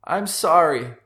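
high, loud, anguished cry; upset, overwrought apology; choked-out apology. A narrator's reading. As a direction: blurt an apology mid-fight. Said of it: "Mark choked out before smacking Paul".

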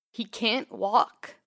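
a husband breaking down over his sick dog, stumbling over words; stuttering grief. A narrator's reading. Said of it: "the man behind me stuttered".

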